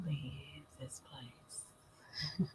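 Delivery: slow, soft secret whisper. Pretend. Vlogger to viewers, slow playful whisper. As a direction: breathe it like a secret, slowly. Teasing about leaving, whispered.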